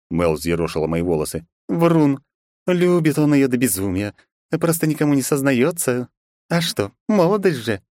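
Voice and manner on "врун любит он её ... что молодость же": higher playful teasing voice; teasing girl voice. Acted. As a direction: tease him lightly, girlish. Mel teasing the narrator.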